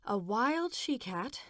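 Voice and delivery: "wild" stretched and stressed, rising question. Acted. Mother repeating with extra stress on "wild". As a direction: lean hard on "wild". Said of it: "extra emphasis to the word wild".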